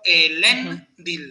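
"elendil" muy lento, con LEN fuerte; acento en la segunda sílaba. Shown as a demonstration. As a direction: marca LEN al decir "elendil". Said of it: "puede ser fuerte la segunda sílaba". ("elendil" silabeado: e-LEN-dil)

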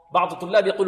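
usual speaking voice

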